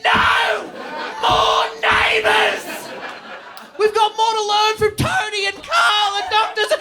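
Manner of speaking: high, drawn-out shouted rant; over-the-top rant. Acted. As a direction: yell it slowly in hammed-up exasperation. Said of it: "that was a bit much".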